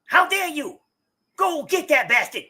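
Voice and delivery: shrill high outraged weasel. Acted. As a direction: screech like an offended weasel brat. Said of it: "he's getting weasel voice".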